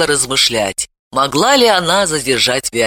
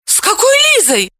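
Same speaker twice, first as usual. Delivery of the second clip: put-on much higher, alarmed girl's shout; frightened cry; 3 dB louder than usual. A narrator's reading. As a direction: blurt it out in a scared yell. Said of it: "закричала она".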